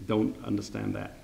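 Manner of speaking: usual speaking voice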